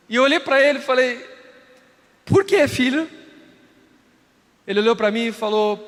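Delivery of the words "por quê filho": surprised question. Retold or imitated. Father asks son why.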